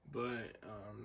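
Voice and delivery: usual speaking voice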